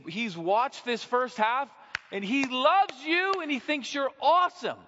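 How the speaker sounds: fiery pulpit declaration on fathering; full-force preaching. Acted. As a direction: proclaim it like a revival preacher.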